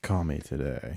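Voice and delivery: low, soft, slow phone sex ad voice; sultry hotline voice. Retold or imitated. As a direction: purr it like a late night hotline ad.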